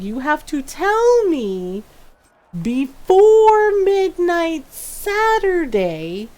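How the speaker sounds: slow, stretched, stressing before; slow emphatic lecture. Retold or imitated. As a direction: spell it out slowly to a teen. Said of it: "And I was like".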